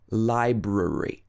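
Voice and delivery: ordinary delivery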